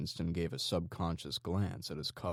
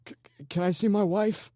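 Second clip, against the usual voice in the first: high, loud stammered call; desperate holler; 8 dB louder than usual. A narrator's reading. Captive hollering after the leader, begging to see his wife. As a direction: shout after him as he leaves. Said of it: "the man hollered to Legion's back".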